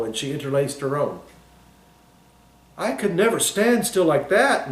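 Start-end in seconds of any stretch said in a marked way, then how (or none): 2.8-4.7 a hint of firm certainty, pitched a little higher; mean every word, lightly; understated emphatic declaration; great conviction. A narrator's reading. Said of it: "with great conviction".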